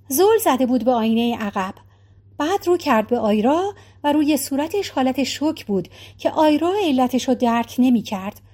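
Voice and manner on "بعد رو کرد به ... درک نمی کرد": plain reading voice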